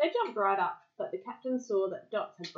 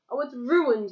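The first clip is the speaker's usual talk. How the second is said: higher, louder storybook moan; mock moan; 5 semitones higher, 7 dB louder than usual. A narrator's reading. Captain moaning over what is ruined, mock moan. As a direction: lament it in a raised voice. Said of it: "he moaned".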